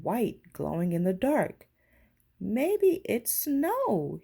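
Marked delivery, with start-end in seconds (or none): 2.4-4.2 high, slow wonder; excited. Acted. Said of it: "he said excitedly".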